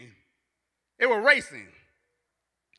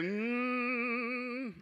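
soft moan instead of words; low hummed moan; 10 dB quieter than usual. Retold or imitated. Aunt trying to talk, only a soft moan. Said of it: "only a moan would come out".

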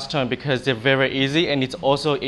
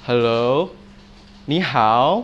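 "Hello" and "你好" with a questioning upward glide; raised second tone; 3 semitones lower, slower than usual. Shown as a demonstration. Teacher showing students the second tone, rising question tune on "Hello" and "你好". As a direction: lift "Hello" and "你好" at the end like questions. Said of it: "raise your voice".

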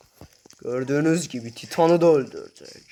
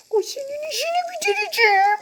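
squeaky Titan voice. Pretend. Gamer voicing the tiny Titan mob, very high squeak. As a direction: make the tiny Titan sound ridiculous and squeaky.

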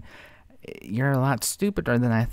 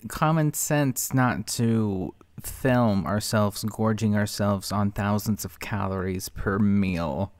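slow, patronizing; 4 semitones lower, slower than usual. Real, not acted. Slow, explaining to Chantal.

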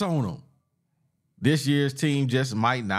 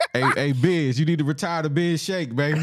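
laughing tease; 6 semitones higher than usual. Real, not acted. Teasing friend while laughing.

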